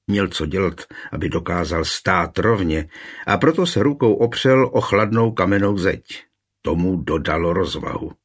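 ordinary delivery, nothing marked

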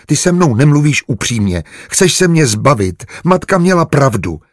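high, upset, close to tears; hurt accusation. A narrator's reading. Tearful maid accuses him. (ublíženě, vyčítavě)

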